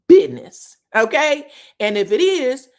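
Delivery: sudden loud, high insistence; a husband laying down the law, loud and insistent; forceful demand. Retold or imitated. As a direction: slam down the word business.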